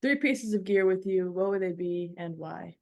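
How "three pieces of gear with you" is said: normal talking voice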